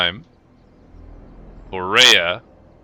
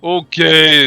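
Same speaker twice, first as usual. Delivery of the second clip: placated ogre; 6 semitones higher, 5 dB louder, slower than usual. Acted. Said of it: "He's like".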